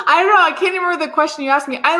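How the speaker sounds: loud, high laughter spilling into words; laughing. Real, not acted. Answering the eager host while laughing. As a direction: giggle and rush through it.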